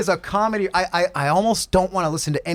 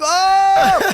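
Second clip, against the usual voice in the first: much higher, louder yelp; mock shock; 13 semitones higher, 6 dB louder than usual. Listener reacting to a breathy car ad, loud mock yelp. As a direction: yelp it like the ad just hit you. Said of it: "I'm like".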